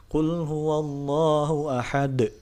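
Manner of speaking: ahad with a bouncing final d; slow recitation, ahad bounced hard; strong echo. Shown as a demonstration. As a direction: qalqalah recitation. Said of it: "qalqalah kubro".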